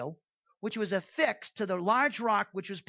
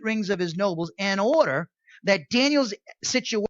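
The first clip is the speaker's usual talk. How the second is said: louder, steady read-out of study notes; reading commentary aloud; 7 dB louder than usual. Acted. Preacher reading word study notes to his class, loud and steady.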